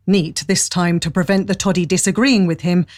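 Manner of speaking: ordinary talk, nothing marked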